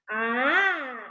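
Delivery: ordinary talk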